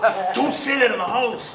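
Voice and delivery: mock scolding. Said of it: "They're like".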